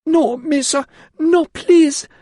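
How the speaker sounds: very high, loud desperate begging; terrified pleading. Acted. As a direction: beg for mercy in terror. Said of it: "she pleaded".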